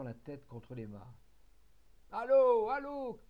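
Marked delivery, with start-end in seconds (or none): 2.1-3.2 very high, very loud desperate cry; dying man's shrill howl; screaming. Acted. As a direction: scream for help while being beaten. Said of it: "hurlait Quérion".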